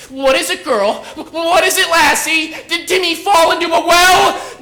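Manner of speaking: high, loud, laughing mockery of a dog owner; mocking Lassie talk. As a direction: taunt her like a dog in the well. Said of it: "So I started to say".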